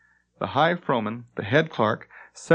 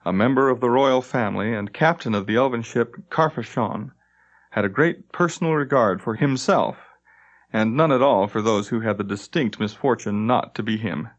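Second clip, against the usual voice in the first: ordinary delivery, nothing marked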